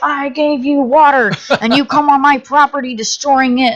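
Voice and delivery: mock outraged neighbour lady; angry neighbour lady. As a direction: scold them like the furious homeowner.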